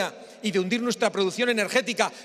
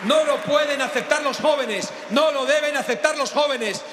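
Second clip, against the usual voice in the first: balcony speech; 4 semitones higher than usual. Real, not acted. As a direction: shout it out to the crowd from the balcony. Rally speech, rousing young people.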